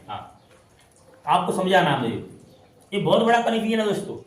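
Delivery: ordinary delivery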